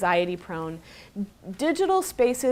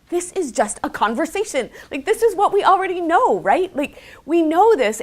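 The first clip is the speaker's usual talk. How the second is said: incredulous laughing; 8 semitones higher, 4 dB louder than usual. Real, not acted. Exasperated at the obvious finding.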